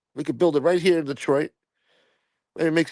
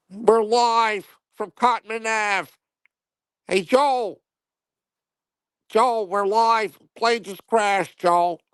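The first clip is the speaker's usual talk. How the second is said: much higher, slow, stiff news reporter voice; TV field reporter; 10 semitones higher, much slower than usual. Acted. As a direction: play a pompous on-scene reporter going live. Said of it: "We need Snuff to go live from the scene".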